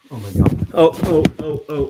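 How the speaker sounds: jolted by a near accident; startled. Real, not acted. Almost hurting the pet, a startled yelp.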